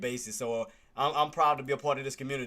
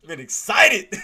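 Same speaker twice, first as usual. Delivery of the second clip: laughing loud, pitched up through it; 7 semitones higher, 7 dB louder, much slower than usual. Real, not acted. Caught trading while the chat talked, laughing. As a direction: laughs it out at himself. Laughing repeat of own words.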